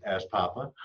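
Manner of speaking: plain reading voice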